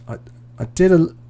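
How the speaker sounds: normal talking voice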